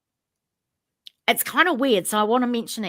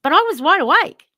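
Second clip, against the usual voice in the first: wide awake said higher and louder, pressing on wide; insistent; 7 semitones higher, 8 dB louder than usual. Real, not acted. Storyteller insisting to listeners she was awake, emphatic.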